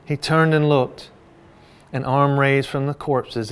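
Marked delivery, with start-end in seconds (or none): none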